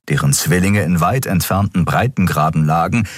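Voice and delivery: ordinary delivery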